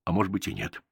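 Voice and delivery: usual speaking voice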